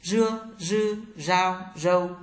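"Rưa, rư, rao, râu" with curled tongue and vibrating cords; buzzed r on "Rưa, rư, rao, râu", held level; voiced retroflex r. Shown as a demonstration. Teacher drills r for learners, "Rưa, rư, rao, râu" voiced and curled. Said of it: "R cong lưỡi và dây thanh rung".

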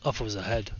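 ordinary talk, nothing marked

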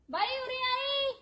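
high, drawn-out holler; making a racket to summon someone; noisy calling out. Acted. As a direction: call them over, stretching the words. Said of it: "ਕਿਉਂ ਰੌਲਾ ਪਾਈ ਜੰਨੀ ਆ".

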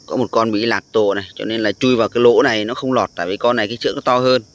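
ordinary delivery

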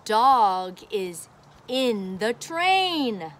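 slow, clear teaching read. Shown as a demonstration. Teacher reading book to child, slow and clear. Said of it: "My turn".

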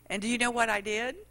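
usual speaking voice

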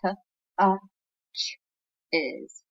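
t- uh ch- is, each sound split with pauses; teacher sounding out letters. Shown as a demonstration. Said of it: "red word here".

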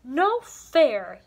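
put-on loud childish yell of no fair. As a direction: yell it like a cross little girl. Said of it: "yelled Emmy".

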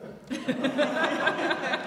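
high cackling laugh from a distance; witch's cackle. Acted. Witch cackling as she shows Gretel the oven, high and strange.

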